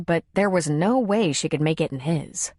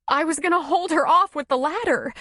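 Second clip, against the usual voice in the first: shaken woman; 9 semitones higher than usual. Acted. Woman recounting bear attack, shaken. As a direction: still shaking from the fright.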